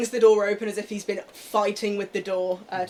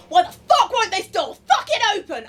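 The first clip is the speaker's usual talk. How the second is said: much higher, furious yell at the door; angry shout; 12 semitones higher, 9 dB louder than usual. Acted. Boss pretending the door is stuck, furious yell. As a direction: fake fury while holding it shut. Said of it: "He's going".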